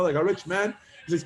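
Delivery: ordinary talk, nothing marked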